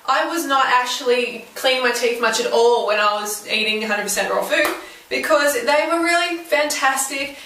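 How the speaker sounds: ordinary talk, nothing marked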